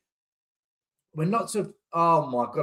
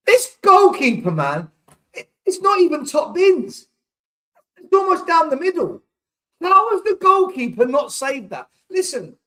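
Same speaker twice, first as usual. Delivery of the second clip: pitched way up, loud and disbelieving; high furious rant; 11 semitones higher, 6 dB louder than usual. Real, not acted. Fan ranting about the keeper.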